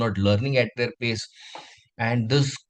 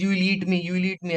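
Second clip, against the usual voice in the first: higher-pitched, repeated acted alarm; fearful protest; 7 semitones higher, much faster than usual. Cub sure the lion will eat him, acted fearful protest. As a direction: protest in a mock-frightened high voice. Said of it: "he's so fearful".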